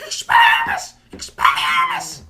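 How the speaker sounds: extremely high, loud, drawn-out yell; Harry Potter. Retold or imitated. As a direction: shriek the spell in a squeaky kid voice. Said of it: "he's like".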